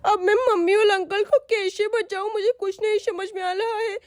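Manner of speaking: put-on high, tearful child voice; a scared girl, near crying, hiding; young girl's frightened voice, high and teary; frightened little girl. Girl Sonam sees dacoits.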